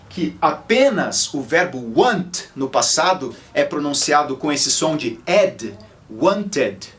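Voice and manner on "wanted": usual speaking voice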